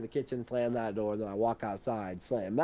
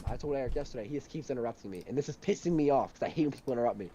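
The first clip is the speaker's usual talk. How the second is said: ordinary delivery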